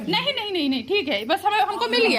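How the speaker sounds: high, loud insistent pleading; pleading refusal. Real, not acted. Daughter refuses money from father, high loud pleading. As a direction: beg him to keep his money.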